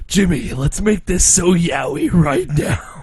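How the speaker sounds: slow, hammy seductive hero voice; mock Yami Yugi. Yugi looking at Jimmy, overdramatic slow come-on. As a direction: draw it out with pompous drama. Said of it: "He's like".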